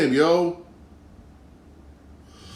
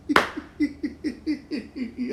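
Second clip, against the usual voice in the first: a real high burst of laughter breaking out; cracking up at the thought; 11 semitones higher, 9 dB quieter than usual. Real, not acted. Laughs at Zimmer point.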